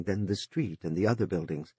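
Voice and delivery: ordinary delivery, nothing marked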